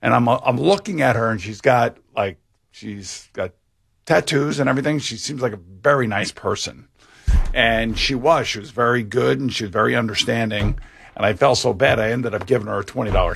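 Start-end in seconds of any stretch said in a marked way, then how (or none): none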